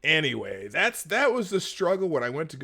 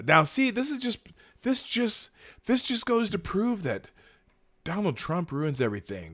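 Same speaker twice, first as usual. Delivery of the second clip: sarcastic; 7 semitones higher than usual. Real, not acted. Sarcastic rant.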